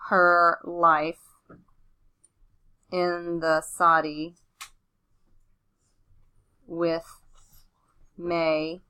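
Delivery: slow, word-by-word dictation; dictating. Shown as a demonstration. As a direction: spell it out as you write. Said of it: "I'm gonna shorten Missus Oblinger".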